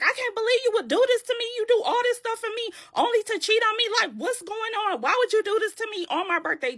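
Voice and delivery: high, rapid-fire outraged rant; going off. Retold or imitated. Tiffany accuses her boyfriend of cheating, going off. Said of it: "Just going complete off".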